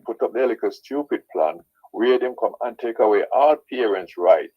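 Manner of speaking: ordinary talk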